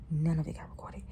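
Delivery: real whisper, breath only. Real, not acted. Vlogger admitting to viewers the footage was lost, whispered. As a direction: drop to a whisper as if confessing.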